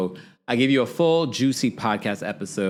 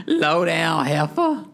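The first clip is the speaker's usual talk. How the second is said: slow, higher drawn-out insult; Mama Joyce impression; 7 semitones higher, 3 dB louder, much slower than usual. Imitating Mama Joyce insulting, slow and higher. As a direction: stretch heifer like an outraged old mother. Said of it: "my voice impression of it".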